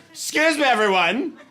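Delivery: lightly formal toast voice; toast announcement. Retold or imitated. Comedian to family at Thanksgiving, mock formal toast. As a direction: tap the glass and announce. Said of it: "like I'm giving a toast".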